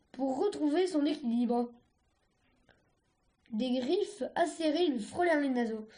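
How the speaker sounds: ordinary delivery, nothing marked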